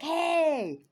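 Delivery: loud, high drawn-out "hey"; calling out. Retold or imitated. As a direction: holler a greeting across the park. People calling out to her.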